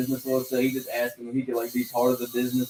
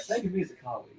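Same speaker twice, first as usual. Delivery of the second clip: soft, slightly higher creepy imitation; Dennis, creepy; 5 semitones higher, 8 dB quieter, much faster than usual. Imitating him offering himself as a colleague, mock creepy. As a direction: softly imitate a creepy therapist type. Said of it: "Dennis is always just creepy".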